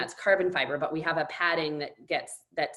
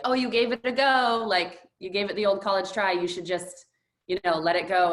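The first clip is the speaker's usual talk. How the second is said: high, louder, sing-song consolation; mock consoling people; 4 semitones higher, 5 dB louder than usual. People at the medical tent telling runner to quit, higher mock consoling. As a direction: imitate well-meaning people giving up on her. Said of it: "I was told by so many people".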